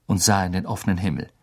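ordinary delivery, nothing marked

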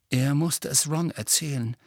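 plain reading voice

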